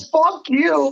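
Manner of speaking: cocky mock Vince. Imagined Vince brushing off John, brash mock voice. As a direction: brush him off like the big boss.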